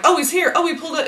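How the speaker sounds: high, excited announcement; mock excitement. Acting out the reaction she didn't have, mock excited. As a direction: squeal it like a big arrival. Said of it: "But it wasn't like".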